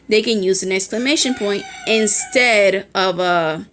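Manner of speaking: ordinary talk, nothing marked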